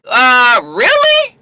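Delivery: high, incredulous confrontation; indignant high-pitched questioning; mock outrage. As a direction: imitate a jealous girlfriend, voice shooting up. Acts out jealous girlfriend confronting.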